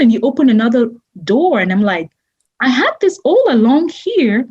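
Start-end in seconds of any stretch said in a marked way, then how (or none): 2.6-4.5 higher pitched, wide-eyed wonder; imitating her own amazement, pitched up; amazed. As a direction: retell the discovery with delighted surprise. Amazed at another door, retold.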